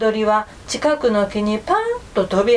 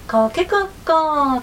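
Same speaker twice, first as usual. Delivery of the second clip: a bright, higher cock-a-doodle-doo; rooster crow; 6 semitones higher than usual. A narrator's reading. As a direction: crow like a young rooster greeting. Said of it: "と挨拶しました". (高めの声で元気に鳴くおんどり)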